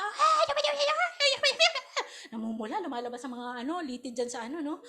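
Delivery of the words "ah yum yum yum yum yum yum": far higher mock quarrel yelling; mock high shouting. Preacher imitating two friends shouting at each other, high mock yell. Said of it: "to the high pitch level".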